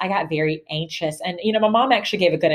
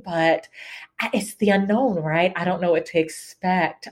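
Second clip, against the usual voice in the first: ordinary talk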